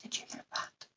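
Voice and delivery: barely audible whispered aside; very soft, voiceless question; hushed whisper. Real, not acted. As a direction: whisper it very faintly. Startled aside after a long silence.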